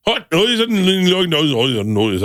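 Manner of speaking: slurred gibberish Irish Traveller accent. Shown as a demonstration. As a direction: start high and slow, tumble down in mock Traveller accent. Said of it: "the traveler's accent".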